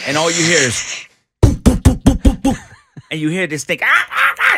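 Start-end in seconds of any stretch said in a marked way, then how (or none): 1.6-2.6 repeated high, loud popping boops, mimicking pellet gun shots; gunshot sound effect. Telling friends how the pellet gun went off, loud high boops. 3.8-4.6 high, loud mock squeals from the trapped rat; imitated rat screams. Retelling the rat screaming in the bedroom, high mock squeals. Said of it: "It's like screaming and whatever".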